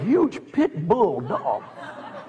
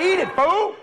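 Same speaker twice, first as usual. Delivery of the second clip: yelling at dog; 9 semitones higher than usual. Retold or imitated.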